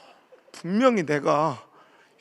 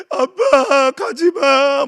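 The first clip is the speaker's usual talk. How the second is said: put-on drawn-out wailing child, much louder; child crying; 8 semitones higher, 12 dB louder, much slower than usual. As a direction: wail like a little girl chasing the car. Little daughter crying after car. (울며 매달리는 어린 딸 목소리)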